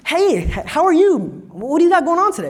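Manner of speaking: a big burly man who turns out sweet and chirpy; friendly old dude. Pretend.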